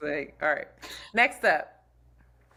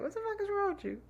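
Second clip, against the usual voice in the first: much higher, softer, playful inflection; mock teasing squeal; 8 dB quieter, faster than usual. Shown as a demonstration. Host echoes cohost's clowning take, high mocking squeal. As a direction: pitch it way up like a joke. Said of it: "broke down everything I said with just the inflection".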